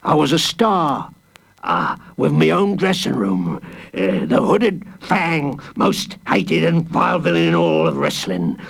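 rising, delighted boasting; gleeful villain reminiscing. A narrator's reading. Wrestler villain boasting of his booing crowds, gleeful. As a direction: savour old glory.

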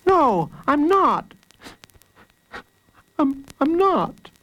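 put-on high, slow child's protest; high-pitched tearful denial; near tears. A narrator's reading. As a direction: protest as a small boy about to cry. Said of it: "suddenly he burst into tears".

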